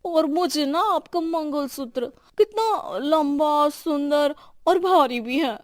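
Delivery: greedy coaxing. Acted. Greedy daughter-in-law coveting necklace.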